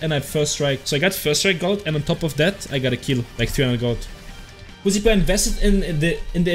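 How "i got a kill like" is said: ordinary delivery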